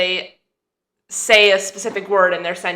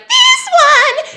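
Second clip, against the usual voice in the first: very high, loud squawk; weird high squeal; 7 dB louder than usual. Shown as a demonstration. Actor marking the next word, ear-splitting high squeal. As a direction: screech the words far above your usual pitch. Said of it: "weird sounds".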